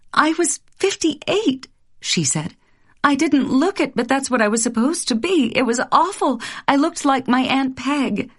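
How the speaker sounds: high, lively recounting; chattering young wife. Acted. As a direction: tumble out the dream details. Wife describes aging dream.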